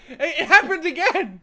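loud, high, laughing outburst; laughing excitement. Real, not acted. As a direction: blurt it out through laughter. Laughing at false alarm.

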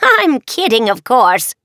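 high cackling witch. Acted. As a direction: cackle the words out. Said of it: "the witch cackled".

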